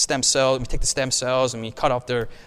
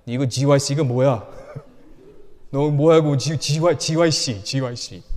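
loud, fast Korean parent scolding; 놀란 한국 아버지 흉내; imitating Korean father; 5 dB louder, faster than usual. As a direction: bark it like an exasperated immigrant dad. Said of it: "My parents are like".

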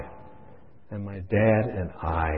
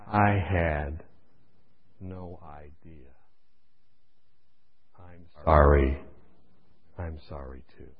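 low, hushed, slow tearful apology; a touch of the weeping dad, low and soft; weeping father; 6 semitones lower, 9 dB quieter, slower than usual. Retold or imitated. Father answering his son in the dark, quietly weeping. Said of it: "And he says".

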